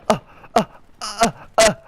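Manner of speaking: short, high stuttered uhs; stunned grunts. Retold or imitated. Piccolo shocked that his beam missed, stunned stammering. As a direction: imitate someone frozen in surprise. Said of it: "Piccolo's so surprised that it missed".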